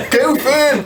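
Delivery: put-on high, loud country-accent call, laughing; hollering in a hammed-up country accent. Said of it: "not with my country accent".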